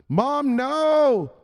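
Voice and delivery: high, loud "Mom, no"; panicked cry. Acted. Son panics on phone.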